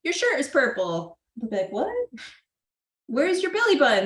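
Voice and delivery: put-on high, playful teasing of a child; silly distracting question. Shown as a demonstration. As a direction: trick them with a silly question. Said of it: "You're like".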